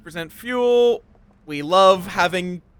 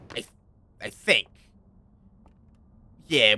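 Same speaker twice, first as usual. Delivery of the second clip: ordinary talk, nothing marked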